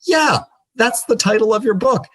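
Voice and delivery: high, bright "Yeah, that's the title of your book" from retelling Kamal; a friend sure he has found the title, said excitedly; excited insistence. Retold or imitated. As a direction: mimic him thrilled and certain, voice way up.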